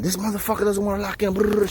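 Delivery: high, motor-mouth imitation with a brrr; mock ranting woman. Officer complaining about him to the squad, mock ranting. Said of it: "She's just running her mouth".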